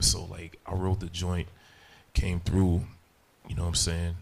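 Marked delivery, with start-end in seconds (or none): none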